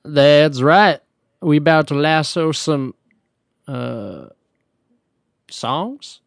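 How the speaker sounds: put-on half-improvised cowboy accent. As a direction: attempt a country accent and run out of words. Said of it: "what else to say in that accent".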